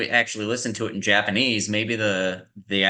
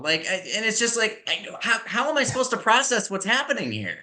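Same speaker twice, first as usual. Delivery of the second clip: high-pitched exasperation; real frustrated outburst; worked-up ranting at the dub; exasperated rant; 12 semitones higher than usual. Real, not acted. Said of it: "I'll stop yelling".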